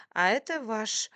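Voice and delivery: stunned, halting and drawn out. A narrator's reading. Waitress recognises her boss at the table, stunned. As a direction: falter slowly in shock on recognising someone. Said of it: "ошарашенно сказала она".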